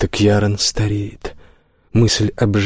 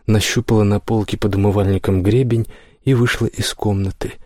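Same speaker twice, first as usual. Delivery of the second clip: usual speaking voice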